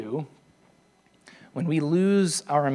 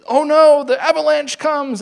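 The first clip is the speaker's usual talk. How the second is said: much higher, louder cartoon cry of alarm; mock panic; 11 semitones higher, 10 dB louder than usual. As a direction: yell it like a kid playing disaster. Kids act out avalanche game.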